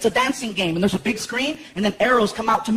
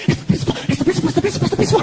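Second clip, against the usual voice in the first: rising higher with each repeat, building panic; panicked kid; 6 semitones higher, much faster than usual. Retold or imitated. Son panicking as the principal approaches. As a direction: climb in pitch as the panic grows. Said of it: "he's like".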